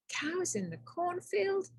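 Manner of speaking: high, soft alarmed question; a hushed, startled cry. Acted. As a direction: gasp it out in disbelief. Tom startled by trick.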